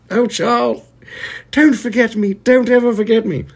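very high falsetto plea to Charles; hammed-up falsetto side chick; pleading mistress. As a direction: beg him like a swooning lover. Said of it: "She was just".

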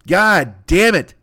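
slow, drawn-out groan of despair; weary disgusted sigh of a curse; exasperated groan. Real, not acted. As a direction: let it sag out of you. Exasperated aside.

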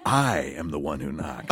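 low, menacing TV-villain line; hammed-up low dramatic delivery; dramatic reinterpretation. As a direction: drop low and declaim it with mock menace. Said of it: "Dramatic reinterpretation".

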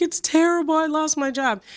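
high mock wailing; imitating an upset daughter's complaint; retold crying. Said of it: "I was so upset, like, crying".

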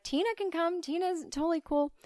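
high, eager enthusiasm; overly keen, high-pitched welcome. Acted. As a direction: jump at the chance to meet her. Eager about half-fish sister.

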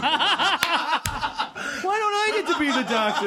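high laughing complaint; laughing whine. Real, not acted.

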